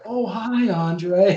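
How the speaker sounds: high, sing-song mock greeting; imitated high-pitched woman's hello; retold sweet greeting. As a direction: lift it way up, like an unfazed wife saying hello. Retold wife greeting the giant.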